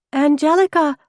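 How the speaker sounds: high, loud, joyful call. A narrator's reading. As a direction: call your sister's name, overjoyed. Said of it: "I called, tearful with joy".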